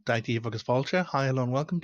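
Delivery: ordinary delivery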